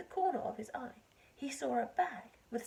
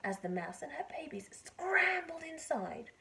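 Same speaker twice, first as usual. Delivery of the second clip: usual speaking voice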